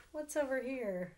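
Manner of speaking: plain reading voice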